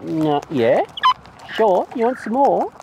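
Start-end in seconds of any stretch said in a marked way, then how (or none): none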